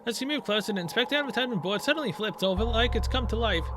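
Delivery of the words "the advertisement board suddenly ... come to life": normal talking voice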